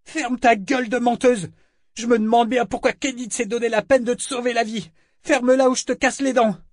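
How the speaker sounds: very high, loud, threatening tirade; shrieked threats, full of rage; piercing angry yell. A narrator's reading. Eta threatens Althea pinned down, yelling piercingly. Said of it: "cria la putain d'une voix perçante".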